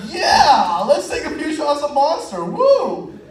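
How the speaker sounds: loud, rising hype ending in a high woo; hyped agreement. Retold or imitated. Agreeing to shots with his roommate, loud hyped cheer. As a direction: cheer it like a frat bro.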